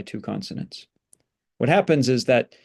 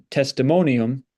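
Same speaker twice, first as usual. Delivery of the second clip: ordinary delivery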